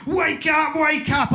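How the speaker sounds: high, loud cry of alarm; panicked shouting. Retold or imitated. Panicking hypnotist trying to wake his entranced subject, shouting. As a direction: shout it at the top of your voice. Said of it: "kind of panicking a little bit and shouting".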